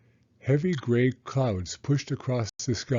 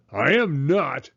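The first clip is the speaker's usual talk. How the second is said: louder, higher snap of a denial; shouted denial; 5 semitones higher, 6 dB louder than usual. A narrator's reading. Cranky Franklin shouting back at his mother, loud protest. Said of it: "shouted Franklin".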